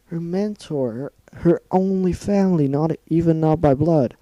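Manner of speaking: rising villain rant. Acted. Rainbow Dash villain monologue.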